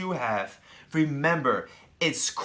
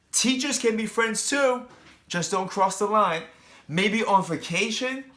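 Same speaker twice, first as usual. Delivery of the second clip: plain reading voice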